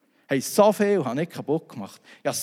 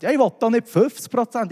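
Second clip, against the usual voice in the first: raised, higher voice, laughing at himself; exasperated outburst; 8 semitones higher, 6 dB louder than usual. Retold or imitated. To the installer offering a discount, a laughing outburst. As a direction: throw the offer back, half laughing. Retold argument over the discount.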